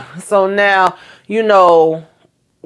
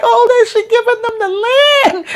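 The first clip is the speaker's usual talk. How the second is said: mock crying. Telling viewers how the land was given, teary high gush easing off. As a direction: start in a wailing mock sob and ease back toward normal. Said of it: "about to make me cry".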